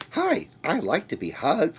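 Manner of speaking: put-on high, loud childish doll voice. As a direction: squeal it like a child's toy. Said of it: "Is he gonna sound childish, like".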